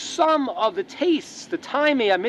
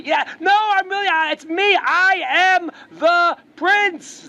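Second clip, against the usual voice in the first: pleading prince; 9 semitones higher, 4 dB louder, slower than usual. Retold or imitated.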